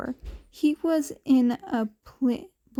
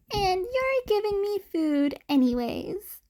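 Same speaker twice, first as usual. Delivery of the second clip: cheeky retort; 8 semitones higher than usual. A narrator's reading. Kid teases teacher.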